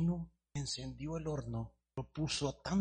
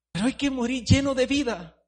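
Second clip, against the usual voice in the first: high, loud preaching cry; preaching shout; 12 semitones higher, 13 dB louder than usual. Acted. Preacher climax.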